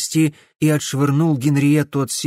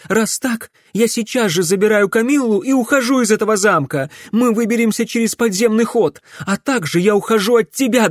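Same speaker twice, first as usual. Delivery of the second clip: high, loud, fed-up outburst; angry ultimatum; 9 semitones higher, 4 dB louder than usual. A narrator's reading. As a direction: declare the break in indignation. Wife announces she is leaving. (гневно, на повышенных тонах)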